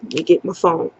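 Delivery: ordinary talk, nothing marked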